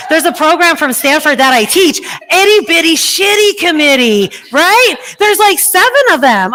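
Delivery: high, loud excited explanation. Real, not acted. Excited teaching peak.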